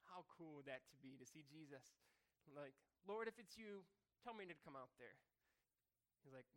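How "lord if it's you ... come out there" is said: eager call out. Acted. Peter calls to Jesus.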